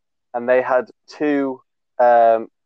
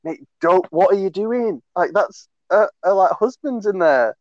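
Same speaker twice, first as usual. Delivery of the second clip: high, urgent panicked plea to a mate; panicked warning; 7 semitones higher than usual. Retold or imitated. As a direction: hiss a frantic warning, voice jumping up high. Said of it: "I was freaking out".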